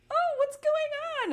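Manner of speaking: loud, very high put-on voice; exaggerated high-pitched impression; mock clueless sleeper. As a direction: imitate her in a squeaky, clueless cry. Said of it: "and she's like".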